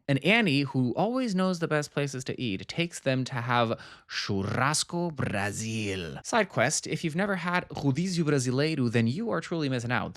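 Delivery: ordinary delivery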